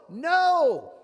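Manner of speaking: a sharp, loud, high no; loud refusal. Retold or imitated. Pharaoh defying the Lord, loud refusal. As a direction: slam the door with one word.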